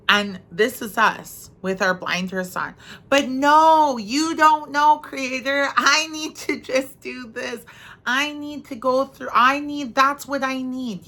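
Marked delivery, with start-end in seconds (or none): 3.1-11.1 high, loud, pleading insistence; childish protest. Retold or imitated. Speaker as a child pleading with creator, high insistent whine. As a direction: beg like a stubborn kid who knows best.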